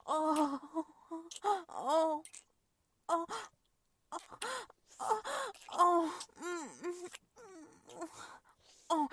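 high, loud rising moans. Acted. Woman nearing climax, high loud moans.

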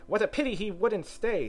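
high, theatrical sigh of regret. A narrator's reading. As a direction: lament it in a high bird voice. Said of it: "sighed the Lory".

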